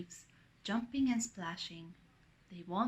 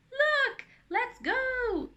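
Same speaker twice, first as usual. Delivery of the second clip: high, loud rallying call; excited shout; 13 semitones higher, 7 dB louder, much slower than usual. A narrator's reading. Ben's on hearing Anna laughing, shouting. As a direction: shout to get friends moving. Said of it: "shouts Ben's".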